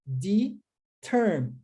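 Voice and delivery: "Determined" very slow, de... termined, long pause between; broken into syllables. Shown as a demonstration. Teacher breaks word for learners, "Determined" in slow separate parts. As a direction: split "Determined" after de, final d tacked on. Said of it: "sem estar no passado".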